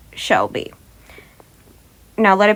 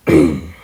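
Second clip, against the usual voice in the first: a low, pointed throat clear; deliberate ahem; 5 dB louder than usual. Acted. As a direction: clear your throat to get attention. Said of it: "he cleared his throat".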